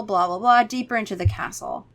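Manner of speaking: ordinary talk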